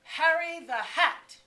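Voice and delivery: high, booming, drawn-out announcement; big slow stage holler; mock announcer. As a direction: announce it to the whole room like a show opener. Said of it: "would stand up and announce to whoever was in earshot".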